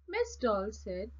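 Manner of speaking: ordinary delivery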